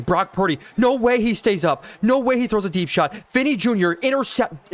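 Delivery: rising live play-by-play thrill; excited shout. Real, not acted. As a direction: yell it as the interception happens. Interception play, live reaction.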